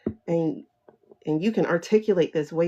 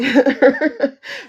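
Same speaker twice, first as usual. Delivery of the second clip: laughing at her own bit; loud laugh; 10 semitones higher, 8 dB louder than usual. Real, not acted. After the caricature, a loud laugh. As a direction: burst out laughing.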